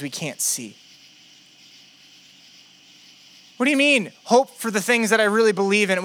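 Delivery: struggling doubter, increasingly exasperated; Philip. Acted. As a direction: plead for proof, pitch climbing. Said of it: "Philip's really struggling in faith".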